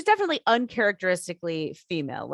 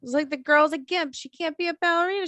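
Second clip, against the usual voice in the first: ordinary delivery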